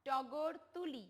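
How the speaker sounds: slow, clear টগর তুলি; teacher repeating the model sentence; slow model reading. Shown as a demonstration. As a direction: say টগর তুলি slowly for the children to follow. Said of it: "যেমন টগর দিয়ে বলেছি না".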